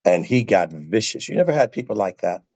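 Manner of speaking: ordinary talk, nothing marked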